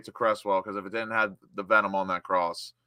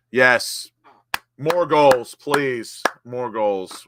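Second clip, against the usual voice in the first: louder, pleading for more goals; eager cheer; 5 dB louder, much slower than usual. Real, not acted. Fan greedy for more goals after a two-nil lead, eager cheer.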